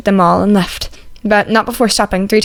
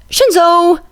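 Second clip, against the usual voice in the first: indignant cry; 9 semitones higher, 3 dB louder than usual. A narrator's reading.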